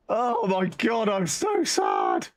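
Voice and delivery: high, drawn-out mock wail; slow, hammed-up lament; self-mocking despair. Real, not acted. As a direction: groan it out slowly in despair at yourself. Lamenting how sad they are.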